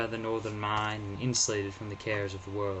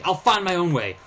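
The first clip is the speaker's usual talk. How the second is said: defiant; 8 semitones higher, 8 dB louder than usual. Acted. Narrator refusing help.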